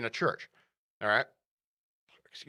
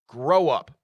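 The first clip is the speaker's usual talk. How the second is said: scolding; 6 dB louder than usual. Real, not acted. Scolding those he criticises.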